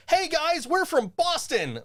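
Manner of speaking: squeaky-high stage hello to the crowd. Retold or imitated. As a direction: chirp it up high like a tiny rock singer. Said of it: "in [name]'s voice".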